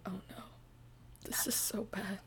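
whispered mock dismay, barely any voice. Reader reacts to hearing the accent in real life, dismayed whisper. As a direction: whisper it as an appalled aside.

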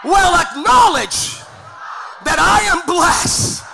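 slow emphatic declaration. Real, not acted. Congregation repeats declaration after him.

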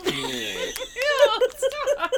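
high, laughing ew stop; laughing disgust. Real, not acted. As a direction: squeal it through laughter. Disgust at chips.

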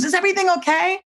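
a startled helper calling up the stairs; worried au pair. Retold or imitated.

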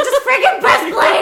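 high, loud, laughing yell; impatient sister yelling to start recording; laughing shout. Retold or imitated. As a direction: bark the order through laughter. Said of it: "And I was like".

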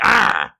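short high cry bursting out; mock exploding yelp; pent-up outburst yell. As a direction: let out a sudden pent-up "ah". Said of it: "if it's pent up, I'm like".

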